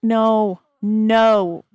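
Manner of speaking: loud, sudden no to stop her; calling out no. Real, not acted. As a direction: shout no across the room. Calling out at the toddler.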